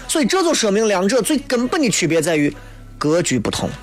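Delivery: ordinary delivery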